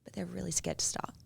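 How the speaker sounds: soft, whispered confession; hushed, as if admitting a secret worry; scared hush. Acted. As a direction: drop to a near-whisper for the fear. Drops voice for the fear.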